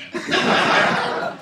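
loud, airy burst of laughter; a quick laugh; short laugh. Real, not acted.

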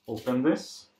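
usual speaking voice

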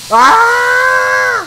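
long, high, loud scream; held high shriek of fright; frightened scream. Real, not acted. Jump scare in horror game.

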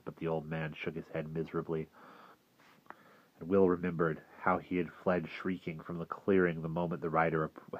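usual speaking voice

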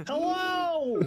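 long, high-pitched called-out hello; yelling to be found. Pretend. Separated player yelling so teammates can find them, yelling. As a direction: call out high so friends can locate you. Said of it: "yell louder so I can find you".

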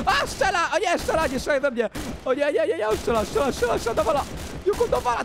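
high, fast-repeated yelps of alarm; playful panic. Pretend. Gamer shot at by the cat, playful panic. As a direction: cry out as if under fire. Cat starts shooting at him.

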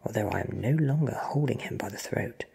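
plain reading voice

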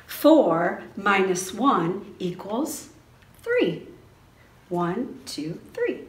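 lively, sing-song read-aloud for toddlers; reading to children. A narrator's reading. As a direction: read it like story time in class. Teacher reads book to preschoolers.

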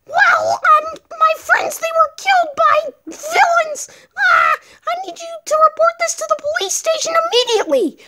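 very high squeaky voice, tearful cries; high-pitched wail, rushing at the end; distressed little mushroom. Acted. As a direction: plead as a tiny creature reporting a crime. Little mushroom reports the killings.

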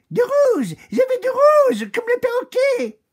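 put-on very high, loud childish demand; greedy demand. Lady Chameleon demands red from the oak, very high and loud. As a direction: shriek it like a spoiled child. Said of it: "s'écria".

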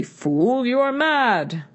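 loud, high, savage rebuke; savage outburst. A narrator's reading. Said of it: "he would not say to him savagely".

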